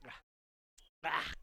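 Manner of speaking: refreshed gasp after gulping; after a drink. Real, not acted. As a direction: sigh out after a sip. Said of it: "hear me slurping or gulping".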